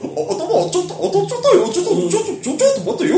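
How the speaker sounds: high cartoon dog voice; Goofy voice. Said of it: "アドリブ入れたね。".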